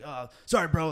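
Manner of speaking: George. Retold or imitated. Fighter imitating his mentor putting him off, raspy impression. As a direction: start imitating a friend's funny raspy voice. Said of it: "That's how he talks".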